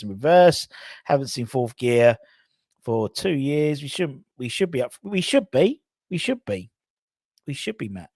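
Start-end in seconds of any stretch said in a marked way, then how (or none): none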